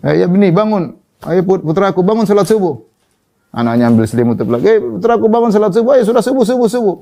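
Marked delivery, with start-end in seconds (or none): 0.0-2.8 rousing a sleeping son, half-acted irritation; mock angry wake-up call. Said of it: "ada orang marah-marah bangunkan anaknya". 4.6-6.9 impatient angry call. Retold or imitated. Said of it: "ada orang marah-marah bangunkan anaknya".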